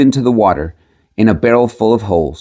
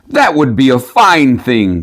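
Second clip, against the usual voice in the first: slow astonished exclamation; 3 semitones higher, slower than usual. Acted. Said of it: "cried the miller".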